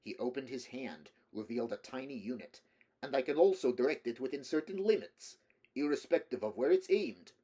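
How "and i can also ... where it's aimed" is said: put-on louder, cheerful Slavic-accented voice. A narrator's reading. Russian man explains his device to Rick, cheerfully. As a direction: gloat brightly in a Slavic accent. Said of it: "Kadalyi said cheerfully".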